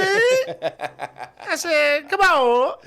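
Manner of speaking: very high, loud hype shout; mock squealing crowd-rouser; shouted stage bit. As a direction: holler it sky-high like a hammed-up club comic. Hammed club stage bit.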